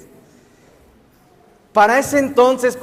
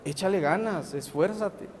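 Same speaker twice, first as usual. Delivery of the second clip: unimpressed mom; 4 semitones lower, 12 dB quieter than usual. Acted. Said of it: "tu mamá te ve y te dice".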